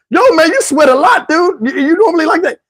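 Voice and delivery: high voice breaking into laughter; amused retelling of a baffled remark; laughing incredulity. Retold or imitated. Laughing, acts out doctor's remark.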